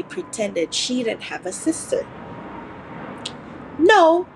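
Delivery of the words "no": sharp, high, loud retort. Acted. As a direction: snap it back curtly. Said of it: "she said sharply".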